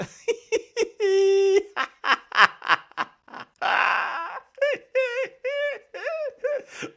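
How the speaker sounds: high wheezing laugh, near tears; can't stop laughing; helpless laughter. Real, not acted. Bursts out laughing. (fou rire aigu, presque en larmes)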